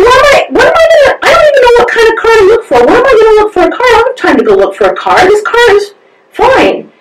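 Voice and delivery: high, fast flustered rant; panicking over having to buy a car; frantic self-talk. Retold or imitated. Driving around town arguing with herself, high and frantic. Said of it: "talking to myself like a crazy lady".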